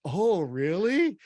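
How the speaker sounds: exaggerated interested reaction; drawn-out surprise. Shown as a demonstration. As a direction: stretch out an amazed "Oh, really?". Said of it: "I wanna go".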